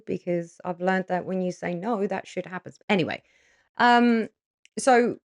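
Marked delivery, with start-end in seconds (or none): none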